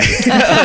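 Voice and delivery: a sudden loud, high laugh bursting out; burst of high laughter. Real, not acted. Giving a shout out to her pool guy, high burst of laughter.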